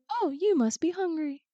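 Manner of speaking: high, gentle, kind voice; a kind girl giving food to a hungry boy; kind sister. Retold or imitated. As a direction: offer the food sweetly. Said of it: "she's like".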